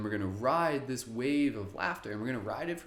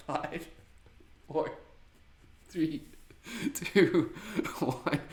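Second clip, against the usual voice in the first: laughing countdown; 7 semitones higher, much slower than usual. Real, not acted.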